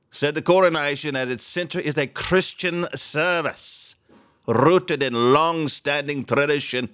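high-pitched mock Scottish accent; put-on Scottish accent. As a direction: slide into a Scottish accent while reading. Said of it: "that's Scottish. What am I breaking into".

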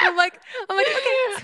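high, overwhelmed squeal; squealing excitedly. Retold or imitated. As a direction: squeak out a giddy okay. Her own squealing reply.

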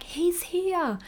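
high call across the house. Retold or imitated. Mother calling out to the arriving doula, high and excited. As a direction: call out to the doula that the baby's here. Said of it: "I yell out to her".

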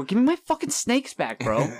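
high, loud, mock-furious demand; angry demand. Buddy demanding his snakes back, mock-angry yell. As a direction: yell it like he stole your pets.

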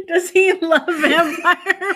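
words squeezed out through real laughter, high and slow; real giggling question; laughing. Real, not acted. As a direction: laugh helplessly while asking. Cracking up at Dad remark.

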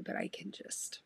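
words catching in the throat; real emotion, barely whispered; choked-up whisper. Real, not acted. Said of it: "I got emotional reading that one".

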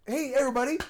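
high, loud mock game-show call; rally the room to play; hammed-up party-game announcer; game-show host.

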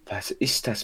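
usual speaking voice

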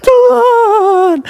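high, loud sobbing wail to God; wailing cry. Retold or imitated. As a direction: wail it like a weeper at the altar. Wail again, cry heard.

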